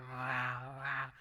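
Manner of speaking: a mild spoken wow wow growl; stomach growl imitation. Preacher mimics a normal stomach growl, mildly. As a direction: imitate a small stomach rumble. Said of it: "you hear".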